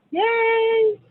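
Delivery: high, loud celebratory yay; excited cheer. Real, not acted. Host cheering the three prize winners, high and excited.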